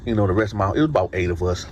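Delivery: ordinary delivery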